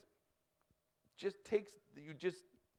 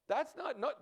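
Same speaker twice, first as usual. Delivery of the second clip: ordinary talk, nothing marked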